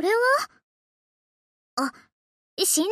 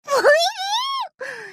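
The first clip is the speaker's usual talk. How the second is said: burst of laughter; 9 semitones higher than usual. Acted.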